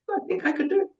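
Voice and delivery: very high, squeaky little offer; mock-timid, sky-high pitch; tiny hopeful voice. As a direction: squeak it out tentatively. Tells director she is ready.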